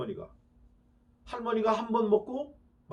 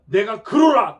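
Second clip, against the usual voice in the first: loud firm declaration; 4 semitones higher, 8 dB louder, slower than usual. Acted. Jesus declaring before high priest.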